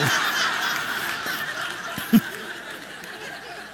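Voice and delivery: long high breathless laugh; can't hold back the laughing; real laughter. Real, not acted. Laughing at the boy's bicycle prayer punchline: breathless laughter. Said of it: "That's great".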